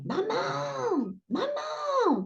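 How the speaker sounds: put-on high, thin child's cry of maman; enfant qui appelle. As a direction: call out like a frightened little girl. Fillette crie la nuit. (appel aigu d'enfant effrayée)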